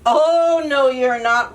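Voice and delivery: loud troll roar. Acted. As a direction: roar it out at the goat. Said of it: "roared the troll".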